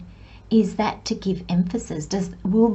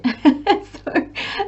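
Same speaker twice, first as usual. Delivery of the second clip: a short rising giggle; bright self-mocking chuckle; light high laugh; 9 semitones higher than usual. Real, not acted. Laughing at own brain leaving.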